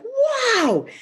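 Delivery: very high, drawn-out wow; a soaring exclamation as the tree bursts free; acted wonder. The tree bursts out of the barn, amazed.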